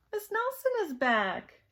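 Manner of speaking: high, loud announcement; excited storybook cry; excited class. A narrator's reading. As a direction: announce it like thrilled pupils. Class greets supposed teacher's return.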